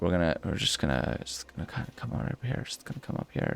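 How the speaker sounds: sneaky unvoiced whisper, transatlantic accent off. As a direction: creep up on him in a whisper. Sneaking up on an enemy.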